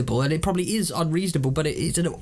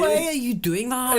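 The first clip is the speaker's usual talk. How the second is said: higher mock Scottish; hammed-up Scottish questioning; Scottish accent; 6 semitones higher than usual. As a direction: put on a bad Scottish accent. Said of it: "going slightly Scottish there".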